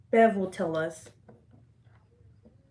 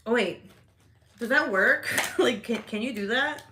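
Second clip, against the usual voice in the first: usual speaking voice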